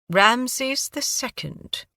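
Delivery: put-on slow, lightly British-accented man. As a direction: savour the name in a refined British accent. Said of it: "Your accent".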